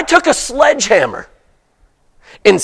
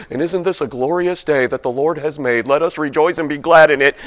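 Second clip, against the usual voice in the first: hollow Sunday-morning piety, lower and quieter; mock pious recitation; 7 semitones lower, 6 dB quieter than usual. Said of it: "that's what I'm supposed to say".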